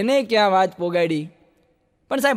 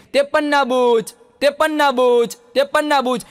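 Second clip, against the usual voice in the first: higher, louder hawker's cry; vendor's call; 5 semitones higher, 5 dB louder than usual. Retold or imitated. Newspaper seller shouting the new headline.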